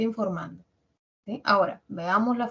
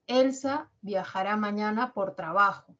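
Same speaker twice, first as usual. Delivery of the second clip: enunciativa que cae en "trabajo"; tono descendente. Shown as a demonstration. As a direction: baja la voz al final, en "trabajo", informando. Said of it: "tener un tono descendente". (entonación enunciativa, final descendente en "trabajo")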